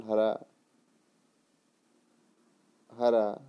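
normal talking voice

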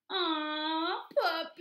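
high, drawn-out coo; adoring gush. Acted. Pigeon longing for puppies, gushing. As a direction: swoon loudly over them, stretching the words.

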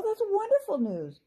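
a trapped fairy thrilled by good news; high joyful fairy voice; happy fairy. A narrator's reading. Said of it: "Amber said happily".

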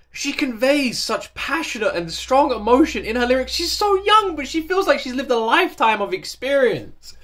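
usual speaking voice

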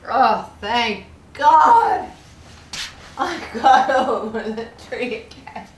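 loud, slow cry of relief; huge relief. Real, not acted. Player finally over the tree, loud relieved cry. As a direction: let relief burst out, drawn out.

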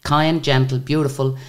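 ordinary delivery

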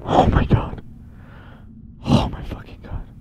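breathless terrified whisper; scared whisper. Real, not acted. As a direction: whisper so he can't hear. Hiding from the chaser.

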